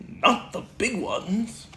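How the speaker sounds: lightly voiced bouncy Tigger; a hint of excited Tigger at the mirror.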